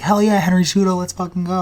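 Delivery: high excited fan cheer; retold hype. Fan recalling cheering Cejudo's UFC signing, high and excited. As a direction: relive the old fan thrill, high. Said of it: "I was like".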